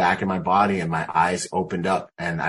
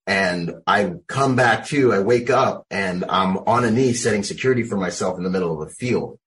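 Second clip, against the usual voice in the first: normal talking voice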